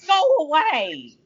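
high, drawn-out exasperated plea; fed-up dismissal. Acted. Viewer telling the camp leader off, exasperated. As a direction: stretch it out, utterly sick of him.

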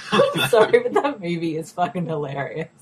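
apologising through real giggles; words breaking into laughter; laughing to tears. Real, not acted. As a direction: talk while cracking up. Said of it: "crying 'cause I'm, like, laughing so much".

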